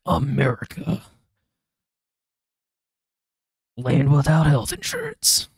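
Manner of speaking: drawn-out, hammed-up American patriot voice; mock-patriotic American proclamation; bald eagle patriot. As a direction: belt it slowly like a screeching bald eagle. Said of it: "bald eagling out and being like".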